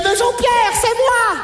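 beaucoup plus aigu, suppliant; l'extraterrestre déguisé en mère supplie; voix de mère. Acted. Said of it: "il m'a dit". (voix aiguë de mère imitée)

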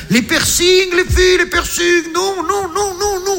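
high-pitched fed-up whine; exasperated squeal. Acted. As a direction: squeal the refusal like you've had enough. Exasperated rant about piercings.

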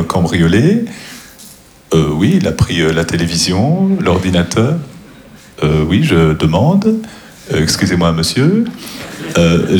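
awkward comedian telling a story in hesitant, lilting deadpan; stand-up comic impersonation. Acted. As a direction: hesitate with "euh", then let each phrase end float upward. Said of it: "je vais faire des imitations".

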